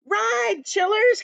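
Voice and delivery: high, urgent rallying call; excited cry. A narrator's reading. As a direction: cry out to hurry the riders. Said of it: "cried Dan".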